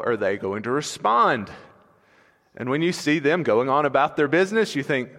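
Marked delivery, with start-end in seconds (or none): none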